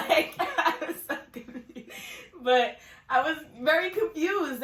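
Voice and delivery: high, loud laughing recap. Real, not acted. Laughing about her own confusion in the story. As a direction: laugh through the words.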